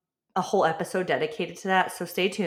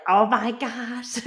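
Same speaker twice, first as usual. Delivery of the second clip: starstruck gasp; 3 semitones higher, much slower than usual. Retold or imitated. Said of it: "which I was like".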